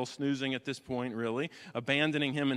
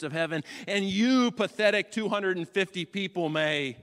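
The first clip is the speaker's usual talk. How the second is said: loud, high rising sermon climax; thundering pulpit declaration; full-force preaching; 5 semitones higher, 7 dB louder than usual. Acted. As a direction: preach it to the rafters.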